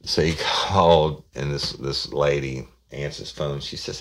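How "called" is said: ordinary delivery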